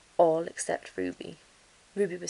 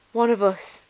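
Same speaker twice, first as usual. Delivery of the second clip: delighted thought; 4 semitones higher, 6 dB louder than usual. A narrator's reading. Ruby's delighted thought continues.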